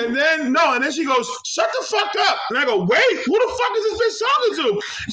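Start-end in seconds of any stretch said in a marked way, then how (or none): 1.5-2.4 very high imitation of a woman cussing; hammed-up high-pitched telling-off; mock angry outburst. Drive-through girl cussing someone out mid-order, mock angry. 2.8-4.8 mock indignant disbelief. Customer reacting to the cussing at drive-through, mock indignant.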